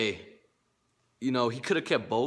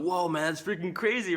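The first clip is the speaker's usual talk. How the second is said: retold excited whoa; 6 semitones higher than usual. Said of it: "And I was going crazy".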